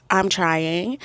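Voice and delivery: ordinary talk, nothing marked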